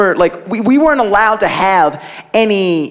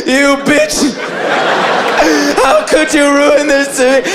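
put-on high, tearful kid accusation; mock crying; 5 semitones higher than usual. Brother accusing mom over ruined cereal, mock crying. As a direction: blubber like a betrayed little boy. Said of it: "Like, crying".

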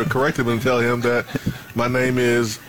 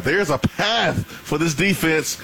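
normal talking voice

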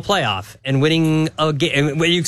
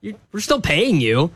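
higher-pitched calling-out voice; imitating a casual job offer; 5 semitones higher than usual. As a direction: call out a breezy mock offer. Voicing coach casually offering a job.

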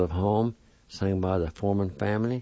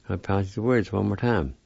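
ordinary delivery

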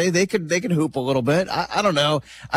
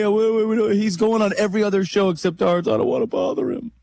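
higher-pitched mocking imitation of timid excuses; mock reluctance; 5 semitones higher than usual. Co-host mocking reluctance to bother a guest, high-pitched mock fretting. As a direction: make fun of someone too hesitant to ask.

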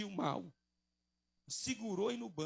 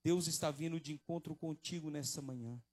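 ordinary talk

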